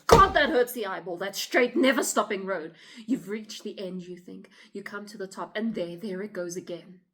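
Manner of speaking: sudden loud cry, easing toward the end; exasperated outburst. Acted. Woman railing to officer about never-stopping road, exasperated. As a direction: burst out loudly against the endless road.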